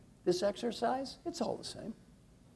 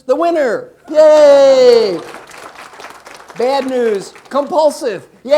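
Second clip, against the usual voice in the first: high, booming cheers for the room; loud cheering; 7 semitones higher, 18 dB louder, slower than usual. Real, not acted. Coach teases a compulsive winner before the room, loud cheering. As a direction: cheer good and bad news alike. Mock cheers good and bad news.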